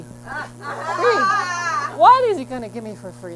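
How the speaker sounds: loud, incredulous free?; mock disbelief. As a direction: ham up the suspicion at a freebie. Mock suspicion at free haircut.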